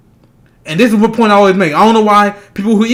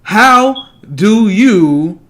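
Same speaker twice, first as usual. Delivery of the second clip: usual speaking voice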